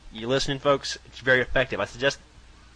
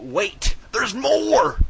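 high, loud TV sales pitch; infomercial announcer; 8 semitones higher, 4 dB louder, much slower than usual. Pretend. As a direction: sell it like a late-night ad.

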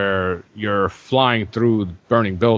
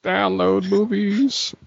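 mock excited poster; 9 semitones higher, slower than usual.

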